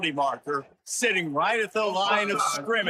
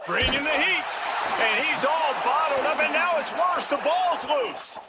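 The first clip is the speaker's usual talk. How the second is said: rising, excited play-by-play yell; touchdown call; 8 semitones higher than usual. Real, not acted. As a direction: build to a big scoring call. Fumble returned for touchdown.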